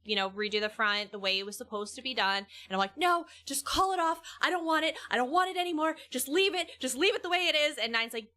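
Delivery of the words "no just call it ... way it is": panicked refusal. Retold or imitated. Retold panicked refusal to husband.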